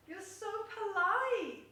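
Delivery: high, slow, drawn-out "polite". Real, not acted. Owner praises her cat Pochi, slow high baby talk. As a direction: praise the cat in a slow sing-song coo.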